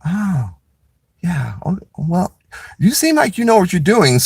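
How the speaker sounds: high, impressed and sweet; sweet public defender. Retold or imitated. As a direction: gush like a surprised helpful lawyer.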